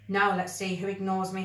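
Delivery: usual speaking voice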